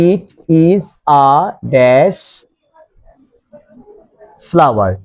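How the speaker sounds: "it", "is", "a", "dash", "flower", each set apart by pauses; halting "it... is... a... dash", long wait before "flower"; word-by-word dictation. Shown as a demonstration. Teacher dictates example word by word.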